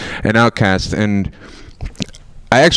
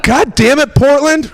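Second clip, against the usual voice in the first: high, louder exasperated yell; much higher exasperated shout; exasperated outcry; 13 semitones higher, 3 dB louder, slower than usual. Real, not acted. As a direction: curse the city in mock despair. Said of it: "exasperated outburst".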